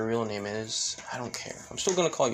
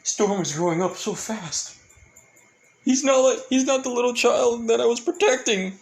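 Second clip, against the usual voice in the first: high, louder mock-tearful lament; over-the-top wistful wail; dramatically; 12 semitones higher, 3 dB louder than usual. A narrator's reading. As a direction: ham up a big brother's sad pride. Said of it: "pretty much dramatically saying".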